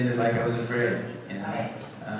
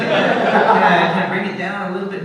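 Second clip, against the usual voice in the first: pitched up high; 8 semitones higher, 10 dB louder than usual. Retold or imitated. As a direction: voice pushed way up, fast and louder. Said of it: "pitch myself up as high as I can".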